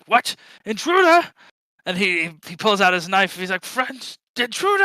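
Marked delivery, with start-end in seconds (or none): 0.1-1.3 high, loud startled cry; startled goblin. Acted. Goblin reacts to alarm. 3.6-4.8 high-pitched goblin outburst; alarmed goblin. Acted. Goblin draws knife, points out intruder.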